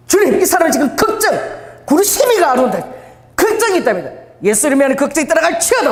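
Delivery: high, loud laying-on-of-hands prayer; fervent prayer. Retold or imitated. Evangelist praying over a worried stranger, high and loud. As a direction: pray over him with full conviction. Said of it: "이상한 소리 막 하는 게 방언하고".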